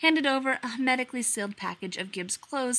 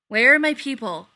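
loud, sharp demand; 4 semitones higher, 10 dB louder than usual. A narrator's reading. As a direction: demand to know, loudly. Gibbs demands his missing team.

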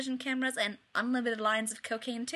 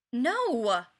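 firm refusal; 6 dB louder than usual. A narrator's reading. Ryan refusing again firmly.

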